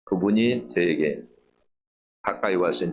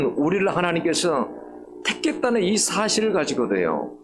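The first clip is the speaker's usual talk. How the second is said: raised, ringing pulpit voice; fervent preaching; 8 semitones higher than usual. Acted. Pastor urging the congregation with fervent raised preaching. Preaching about God's love.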